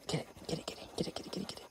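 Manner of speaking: rapid whispered get it; whisper, egging the kitten on; whispered coaxing. Real, not acted. Speaker whispering to the kitten to pounce.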